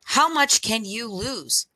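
ordinary delivery, nothing marked

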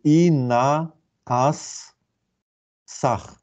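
very slow, drawn-out syllables with gaps between them. Shown as a demonstration. Lecturer deciphering cuneiform signs for students, syllable by syllable. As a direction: sound out "i na as sach" sign by sign.

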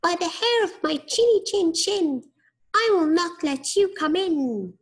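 high squeaky pig voice; little pig voice. A narrator's reading. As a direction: squeak it defiantly like a piglet. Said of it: "said the little pig".

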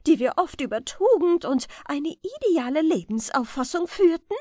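loud, high indignant scolding; outraged queen. A narrator's reading. Queen scolds her pregnant rat friend, loud and outraged. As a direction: berate the fallen friend, rising fury.

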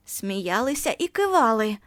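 ordinary delivery, nothing marked

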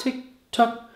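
creepy ticking whisper. Acted.

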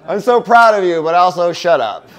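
loud, high mock-proud reply, half laughing; joking exasperation. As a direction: act out an exasperated cheer for a friend. Acts own jokey exasperated reply to friend.

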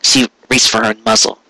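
plain reading voice

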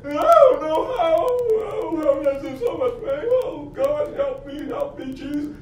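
imitating the wife crying out in pain, high and wailing; mimicked tearful cry for help; retold crying plea. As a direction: plead through tears like someone in agony, mimicked. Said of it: "Start begging".

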